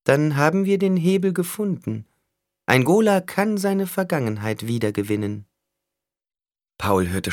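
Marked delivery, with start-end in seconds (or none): 0.0-5.5 high-pitched character voice; sly negotiator Skitale, probing. A narrator's reading. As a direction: speak high, coolly questioning a rival. (hohe Figurenstimme, lauernd fragend)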